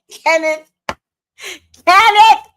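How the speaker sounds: loud, high laughing call; laughing shout. Real, not acted. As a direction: holler his name through laughter. Calling to Kenneth, laughing.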